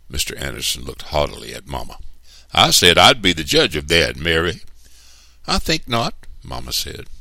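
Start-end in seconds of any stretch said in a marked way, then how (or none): 2.5-4.6 loud, raised, domineering reply; haughty rebuke. A narrator's reading. Mister Anderson rebukes Mama for questioning, loud and haughty. 5.5-6.1 high, cool refusal; firm refusal. A narrator's reading. Mama turns down Mister Anderson, high and firm.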